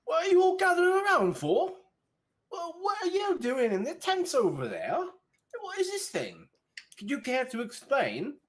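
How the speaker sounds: put-on an officious young guard scolding; indignant guard. Guard confronting the party, high and indignant.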